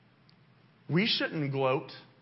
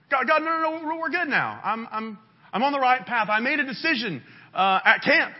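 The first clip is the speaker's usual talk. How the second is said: high, loud, flustered backpedalling; hammed-up excuses; 7 semitones higher, 6 dB louder than usual. Caught sinner assuring God it's fixed, flustered insistence. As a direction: play someone caught out, hastily reassuring in a raised pitch.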